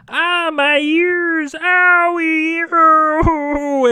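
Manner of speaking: high, drawn-out comic wail; hammed-up cry of hurting ears; mock pained wail. Man hit by the dog's sonic scream, mock wail.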